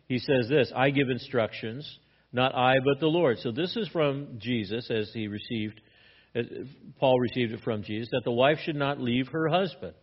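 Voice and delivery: ordinary delivery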